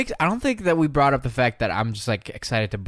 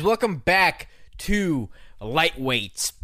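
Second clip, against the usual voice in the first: slow, higher, drawn-out welcome; mock podcast intro; 5 semitones higher, much slower than usual. Guest parodies the host's opener, slow and hammed-up. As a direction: stretch out welcome like a parody host. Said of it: "Let me try".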